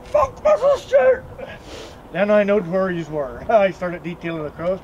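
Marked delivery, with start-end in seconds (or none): 0.1-1.2 high, loud, drawn-out shout; defiant yell. Retold or imitated. Angry boy roaring about the neighbour, loud and high. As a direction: bellow it in defiance. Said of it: "The next thing was".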